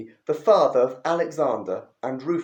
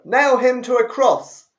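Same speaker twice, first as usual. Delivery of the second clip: even louder, higher mob shout; louder crowd yell; 8 semitones higher, 8 dB louder than usual. Acted. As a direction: top the last yell, a crowd out of control. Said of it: "they yelled all the louder".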